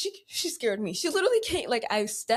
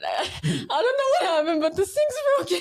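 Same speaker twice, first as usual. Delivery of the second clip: higher, giggly admission; amused mock confession; 8 semitones higher than usual. Imagines telling Dad about the sink, amused. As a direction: confess while nearly cracking up.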